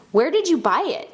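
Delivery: high, curious ask; eager question. A narrator's reading. Boy admires the dragon kite, high eager question.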